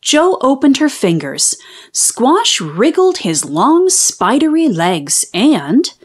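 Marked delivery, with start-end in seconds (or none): none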